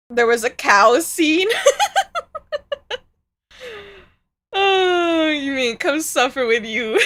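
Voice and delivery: high cackling laughter over the words; breaking into giggles mid-sentence; laughing. Real, not acted. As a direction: laugh through it, can't stop. Laughing at chat.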